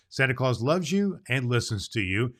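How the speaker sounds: ordinary talk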